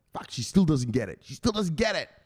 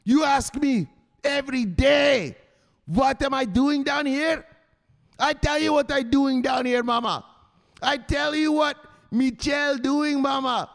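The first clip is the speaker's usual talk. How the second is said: mock angry husband; 12 semitones higher, 6 dB louder than usual. Michelle's voice grows angry.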